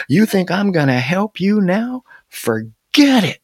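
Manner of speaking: indignant refusal. A narrator's reading. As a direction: throw the refusal back, stretching the last words. Woodchuck indignantly refuses to help.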